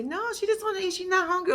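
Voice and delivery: high-pitched mimicked child voice; little girl. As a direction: pipe up like a little girl stepping in. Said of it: "So the little girl decides to get up and say".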